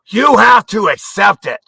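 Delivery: high, loud mocking demand; mock shout. Ranting at viewers, mocking elites with a shout. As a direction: yell it like you're forcing it on them.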